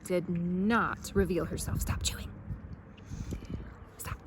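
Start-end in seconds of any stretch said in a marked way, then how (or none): 1.8-4.2 whisper. Real, not acted. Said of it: "aside to crew".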